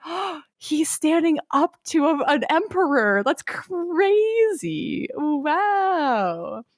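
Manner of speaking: high, drawn-out mock awe; impressed onlookers. Everyone watches Buggy stand up to an emperor, in mock awe. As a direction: gasp and stretch it in hammed-up amazement. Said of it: "they're all impressed by it".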